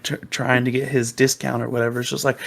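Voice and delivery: ordinary talk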